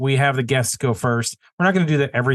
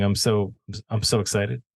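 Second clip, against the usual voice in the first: pretending to be choked up, softer and lower; mock choked up; 3 semitones lower, 5 dB quieter than usual. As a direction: feign being too moved to speak, quietly. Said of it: "my eyes are watering".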